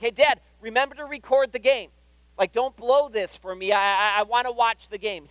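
high, loud imitation of an anxious-to-watch son; pleading eagerly, as a kid to Dad; urgent kid. Son reminding dad at bedtime to record, urgently.

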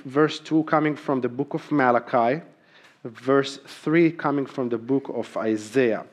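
ordinary talk

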